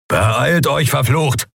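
ordinary delivery

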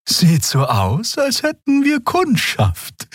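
note the visitors warily; Xia wary. A narrator's reading. Xia spots lights on ship.

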